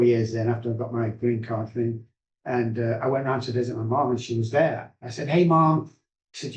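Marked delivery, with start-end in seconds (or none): 5.3-5.9 higher, louder greeting to mom; acted-out hail to his mother; calling greeting. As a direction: retell a bright hello, pitched up.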